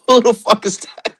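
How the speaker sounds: high laughing disbelief; cackling who the fuck is that. Real, not acted.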